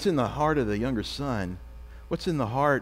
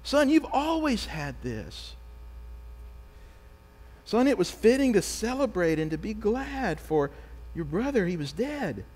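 gentle pleading father; 7 semitones higher than usual. Retold or imitated. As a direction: plead tenderly with a wayward son. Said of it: "The father responds".

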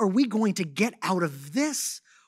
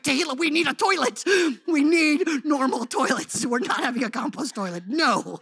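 higher and louder firm exasperation; exasperated insisting; 8 semitones higher, 7 dB louder than usual. Retold or imitated. Husband insisting to his wife on normal toilets, exasperated and firm. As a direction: put your foot down, fed up. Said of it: "I'm like".

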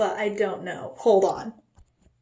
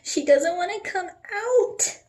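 squeezed, much higher voice; high-pitched strain; 8 semitones higher than usual. Real, not acted. Strained at stuck bottle, then joked about.

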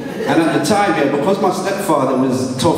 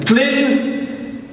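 higher, formal schoolmaster calling on a pupil; stern teacher voice; 5 semitones higher, slower than usual. Retold or imitated. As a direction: play a Cambridge-educated English teacher. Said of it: "like this".

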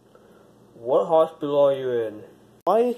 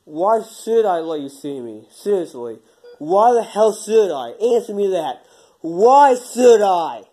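slow and louder, rising into a yell; building angry yell; 3 semitones higher, 9 dB louder than usual. Acted. Hospitalized man refuses to tell his friend, building to a yell. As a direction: drag the words out, getting angrier.

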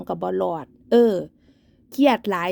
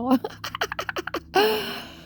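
high, sneering laugh at her; mocking laugh; 10 semitones higher, 3 dB quieter than usual. Real, not acted. Vlogger mocking her rival with a high sneering laugh. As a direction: laugh in scorn at the woman she's ranting about.

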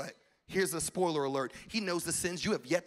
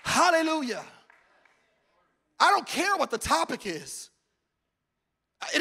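loud, high, fervent pulpit shout; a preacher at full cry calling the church to prayer; preaching at full force; 9 semitones higher, 12 dB louder than usual. Acted.

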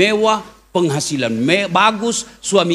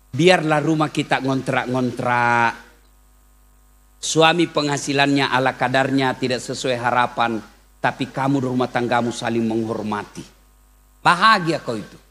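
gentle counsel; 8 semitones lower, 3 dB quieter than usual. Real, not acted. Preacher consoles the congregation softly.

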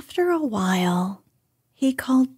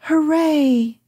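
higher, lightly raised narrated cheer; a restrained storybook hooray, pitched up; mild shout; 6 semitones higher than usual. A narrator's reading. Boy cheering up to his bear friend, mild shout. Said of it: "you shouted".

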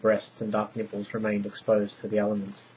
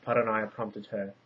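plain reading voice

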